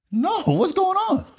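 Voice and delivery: high, slow, bewildered plea; accused airman asking investigators in a slow, high, stunned voice; alarmed disbelief. Retold or imitated. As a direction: stretch it out, stunned. Retold reaction to accusation.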